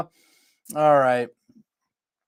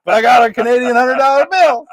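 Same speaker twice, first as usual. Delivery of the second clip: mock flustered guy; 6 semitones higher, 4 dB louder, faster than usual. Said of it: "He's like".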